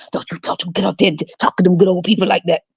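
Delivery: quick, low hammed-up character voice; mock slave parent. Parent warning a child off good people, hammed-up servile voice. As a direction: rush it as a servile old-time parent. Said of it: "Why are you talking like that?".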